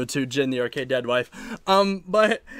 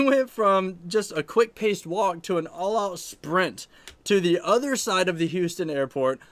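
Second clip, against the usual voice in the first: usual speaking voice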